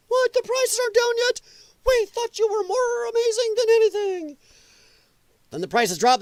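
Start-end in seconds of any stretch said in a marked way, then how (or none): 0.1-4.4 high, mocking whine of a disappointed fan; mocking whiny Democrat. Vlogger mocking angry Democrats, high mocking whine. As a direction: squeak it out like a let-down believer. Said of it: "They're cussing Trump".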